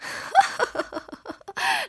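high witchy laugh; acted high peal of scorn; mocking laugh. A narrator's reading. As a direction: laugh derisively, pitched very high. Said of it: "lachte die Girundel".

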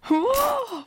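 high, loud, held whoop; delighted whoop. A narrator's reading. Nota hears the new loop growing, whooping in delight. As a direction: whoop with joy. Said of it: "freute sich Nota".

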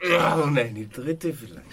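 loud slow villainous taunt; wicked. Acted. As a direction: taunt slowly like a schemer. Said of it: "ihr bösen, bösen Menschen".

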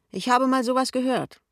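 high, loud, sly reply; prying colleague. A narrator's reading. Kessler answers slyly.

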